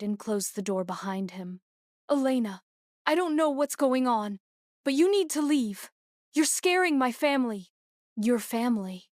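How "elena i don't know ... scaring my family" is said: urgent demand. A narrator's reading. Husband urges her to leave.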